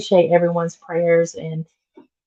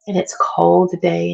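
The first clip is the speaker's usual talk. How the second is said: ordinary talk, nothing marked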